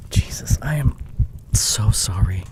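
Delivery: a breathy whisper of apology; hushed horrified apology; remorseful whisper. Acted. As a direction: whisper it, shaken and sorry. Shocked remorse after hurting her.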